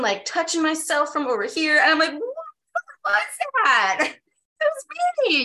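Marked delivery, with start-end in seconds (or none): 2.1-5.4 high, stunned what was that; stunned disbelief. Retold or imitated. Woman replaying her own dance, stunned and high. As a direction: marvel at yourself, half laughing. Shocked by what she did.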